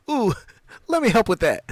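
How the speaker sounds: Kel voice. Retold or imitated. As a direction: chirp it like an overeager kid. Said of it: "And Kel, and Kel goes".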